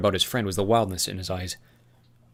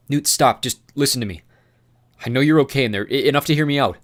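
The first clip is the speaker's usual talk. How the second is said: urgent plea; 5 semitones higher, 8 dB louder than usual. Acted.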